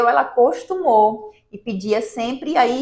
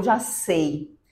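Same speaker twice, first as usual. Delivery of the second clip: ordinary talk, nothing marked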